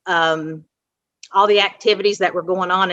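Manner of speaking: ordinary delivery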